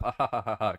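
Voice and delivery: polite, half-hearted "ha, ha"; imitated polite laugh. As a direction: fake a dutiful audience chuckle. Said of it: "polite laugh".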